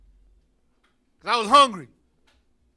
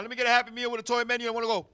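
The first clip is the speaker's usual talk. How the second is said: rushed order; 4 dB quieter, much faster than usual. Retold or imitated. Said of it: "like going to".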